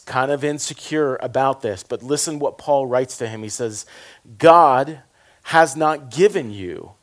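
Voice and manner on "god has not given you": loud, slowed opening of scripture; preaching the verse with force; proclaimed emphatically. Acted. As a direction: boom out the first words.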